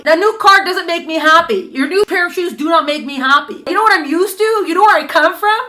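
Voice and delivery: a princess soul that won't be bought off; indignant soul. Acted. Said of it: "your Nefesh Elokis is like".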